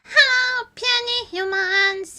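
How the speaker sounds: ordinary delivery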